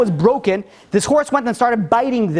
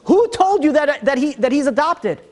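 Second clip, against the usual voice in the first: high-pitched, agitated questioning; worked-up, acted interrogation; insistent demand; 8 semitones higher than usual. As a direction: act out a king demanding a name. King demands who revealed the secret.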